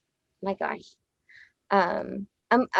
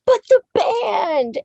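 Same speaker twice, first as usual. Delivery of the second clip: high, loud, drawn-out mock wail; hammed-up tearful protest, stretched out; imitated crying wail; 11 semitones higher, 5 dB louder, slower than usual. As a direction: wail it like a heartbroken retelling. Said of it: "crying to my roommate".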